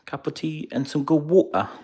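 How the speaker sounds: high-pitched mock-British voice; hammy high British accent; exaggerated British. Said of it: "if I wanna be more British".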